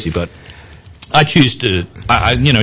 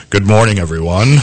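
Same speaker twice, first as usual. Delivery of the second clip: radio voice. Pretend. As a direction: put on a booming DJ hello. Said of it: "I'd be".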